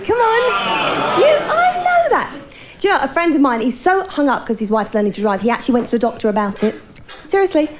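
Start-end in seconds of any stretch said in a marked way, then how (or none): 0.1-2.3 teasing. Real, not acted. Teasing a man in the audience.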